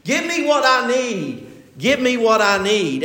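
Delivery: threatening demand, lightly acted; retold threat. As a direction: press it like a shady fella leaning on an old man. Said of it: "he keeps threatening the old fella".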